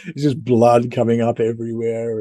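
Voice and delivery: ordinary talk, nothing marked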